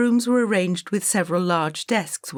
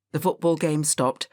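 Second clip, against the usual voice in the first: ordinary delivery, nothing marked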